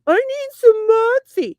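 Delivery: high, slow, whiny craving voice; mocking whine. Mocking husband craving soda, high slow whiny plea. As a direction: drag it out like a needy kid begging. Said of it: "the other day you were like".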